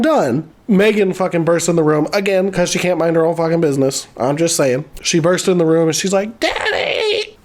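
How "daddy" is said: very high-pitched imitation of a daughter calling; hammed-up high cry; mock distressed cry. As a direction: squeal it like a daughter bursting in.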